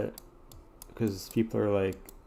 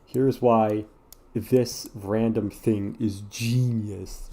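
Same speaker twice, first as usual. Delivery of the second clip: slow, drawn-out awed narration; mock clickbait narrator; much slower than usual. Mocking a video that calls a random thing genius, slow. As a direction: stretch it like a breathless essay title. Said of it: "the ones that are like".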